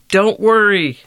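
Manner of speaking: high, loud reassuring shout. A narrator's reading. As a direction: call out to a falling friend. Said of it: "shouted Box".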